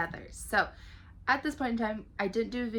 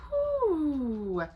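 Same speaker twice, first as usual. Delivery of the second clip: long, high, loud "whew"; relieved whew; 7 semitones higher, 5 dB louder than usual. Real, not acted. Host to viewers before heavy astrology news, big whew. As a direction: let out a big drawn-out whew.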